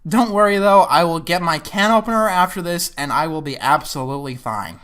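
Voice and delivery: ordinary delivery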